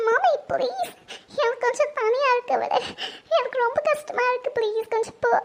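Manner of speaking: tearful pleading. Acted. Daughter asking her mother for time alone, tearful pleading. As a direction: plead through tears to be left alone. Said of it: "ஆந்திக்கம்மா, அழுவாதம்மா".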